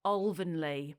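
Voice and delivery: "Alvanley" repeated carefully as OL-vun-lee; local Alvanley pronunciation. Shown as a demonstration. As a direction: copy the local "Alvanley" with a rounded OL. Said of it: "this is the pronunciation".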